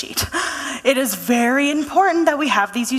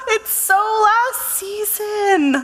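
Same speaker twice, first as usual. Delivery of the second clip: high, drawn-out dismissive scoff; exaggerated disdain; 9 semitones higher, much slower than usual. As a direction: stretch every word, pitched high, as if it's obvious. Dismissing the old tree, acted.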